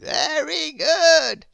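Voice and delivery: high, slow, loud wizard; a grand old wizard, astonished. Acted. The Wizard puzzled at Grizzle's noises, high wizard voice.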